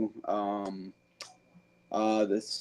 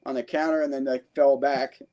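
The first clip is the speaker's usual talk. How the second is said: ordinary delivery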